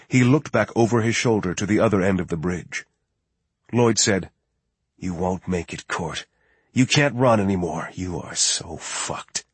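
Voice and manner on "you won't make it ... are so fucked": gloating mirth. A narrator's reading. Lloyd taunting Court on the monitor, quiet mirth. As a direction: taunt softly with a trace of mirth. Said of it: "There was mirth in his voice".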